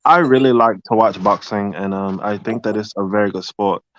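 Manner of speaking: measured, careful British accent; imitation British, carefully spoken. Shown as a demonstration. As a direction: put on your best British accent. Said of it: "Your best, uh, British accent".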